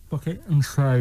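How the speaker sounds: fingers pulling mouth wide; lips stretched. Shown as a demonstration. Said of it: "put your fingers like that and just say bucket and spade".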